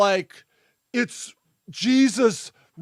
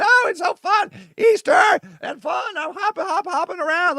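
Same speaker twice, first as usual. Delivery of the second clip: giddy bunny; 4 semitones higher than usual. Acted. Bunny gushing about Easter.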